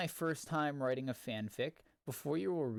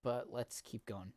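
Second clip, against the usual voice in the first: ordinary delivery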